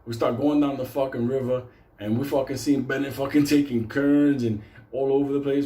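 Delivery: ordinary talk, nothing marked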